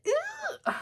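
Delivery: very high, thin delighted squeal; excited squeal. Real, not acted. Vlogger thrilled with her sticker setup, excited squeal. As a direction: let out a tiny high squeal of glee.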